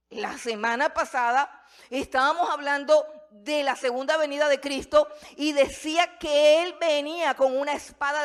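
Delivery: loud, high fiery preaching; preaching at full force. Real, not acted. Pastor describing Christ's return to his church, fiery preaching. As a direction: thunder it out to the congregation.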